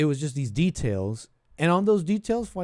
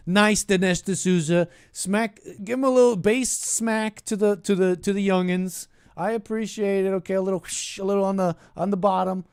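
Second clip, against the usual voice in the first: playful cheering; 6 semitones higher, 5 dB louder than usual. Real, not acted. Host playfully applauding Dinesh D'Souza's smack to the youngins, playful cheering. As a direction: cheer the jab on, higher and louder.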